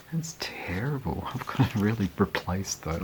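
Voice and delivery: whispered aside; hushed whisper of dismay. Real, not acted. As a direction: whisper it as if to yourself. Aside about the broken records.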